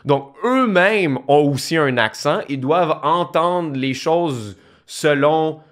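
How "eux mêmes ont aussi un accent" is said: ordinary talk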